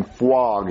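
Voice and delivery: "flog" said slowly as "fwog"; low, drawn-out "flog" as "fwog"; imitated mispronunciation. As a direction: mimic the mistake, "flog" with a W sound. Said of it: "you're saying it wrong".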